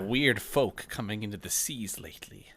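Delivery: put-on lightly gruff old pirate; a touch of salty sea dog. GM as Merrick.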